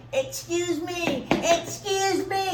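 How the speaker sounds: very high, slow squeaky excuse me; timid little guy. Retold or imitated. A little Connecticut delegate pleading amid a brawl, high and squeaky. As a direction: squeak it out like a tiny weasely man. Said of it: "He's like".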